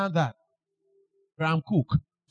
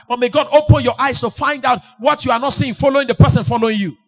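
full-force preaching; 3 semitones higher, 7 dB louder than usual. Acted. Preacher shouting at the congregation. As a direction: thunder it at the crowd.

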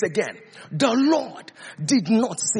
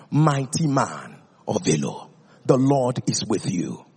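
low, solemn divine voice; voice of the Lord; 10 semitones lower than usual. Acted. The Lord calling Gideon at the winepress, low and solemn. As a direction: speak as God, deep and grave.

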